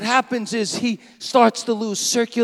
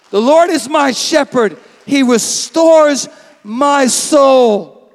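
loud, very slow declaration; louder slow proclamation of the psalm; preaching proclamation; 7 dB louder, slower than usual. Real, not acted. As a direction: lay the verse down slowly with full force.

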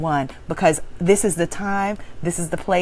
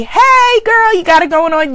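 put-on high, loud, bubbly hey girl; cheery card greeting; 10 semitones higher, 6 dB louder than usual. Reading out a greeting card, high and gushing. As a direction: gush like a friend hyping you up.

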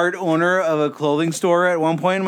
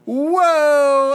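mock surprise; 9 semitones higher, 4 dB louder than usual. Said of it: "And I'm like".

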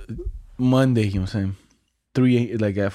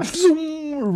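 high swooshing zoom; high zoom whoosh; zoom sound effect; 14 semitones higher than usual. Retold or imitated. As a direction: sound effect of a chicken dashing. Chicken running on camera.

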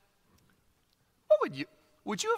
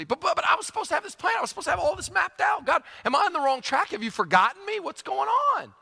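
high, fast, pleading cry of a disappointed believer; the voice jumps up, almost shouting, asking where God went; anguished plea to God; 10 semitones higher than usual. Acted. Exiles complaining to God.

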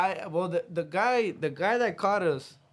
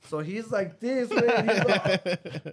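laughing while describing; chuckling through words; 5 semitones higher, 6 dB louder, much slower than usual. Real, not acted. Laughing describing cousin cramped.

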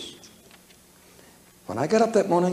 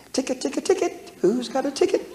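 high mock pleading cry of a ticket beggar; mock street beggar; 9 semitones higher than usual. Preacher mimics a beggar hawking for tickets, hammed-up and high. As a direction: ham up a beggar calling for tickets, pitched high. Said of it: "go on the street and say".

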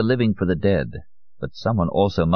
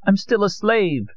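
very high, slow lament; high-pitched, slow despair; despairing cry. Acted. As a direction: cry it out in anguish, drawn out. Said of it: "Amparo cried".

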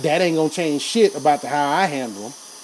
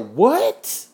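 incredulous; 6 semitones higher than usual. Real, not acted. Disbelief at jail money.

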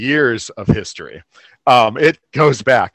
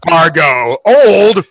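slow, louder mock offense; mock indignant; 3 semitones higher, 6 dB louder, much slower than usual. Friend defending the boyfriend's age, mock indignant. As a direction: draw it out like a scandalized defender. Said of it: "and I'm like".

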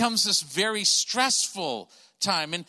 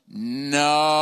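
long, loud-swelling, low-pitched slow-motion cry; 5 semitones lower, 4 dB louder than usual. Retold or imitated. Dad sees the cart about to hit his son, slow motion. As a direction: stretch one word out as in a slowed-down film. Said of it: "it's almost like slow motion".

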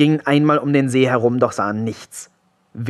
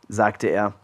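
plain reading voice

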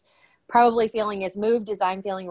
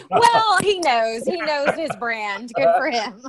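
playful tease; 6 semitones higher, 4 dB louder than usual. Real, not acted. Host teasing guest about the Noble name, high playful sing-song.

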